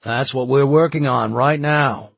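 announcing. A narrator's reading. Charlie answers angry bystanders, announcing loudly. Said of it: "Charlie announced".

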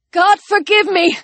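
high, strained prayer; anguished plea. Acted. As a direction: plead for forgiveness before acting. Young man about to shoot.

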